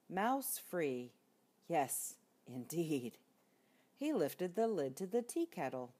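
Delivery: plain reading voice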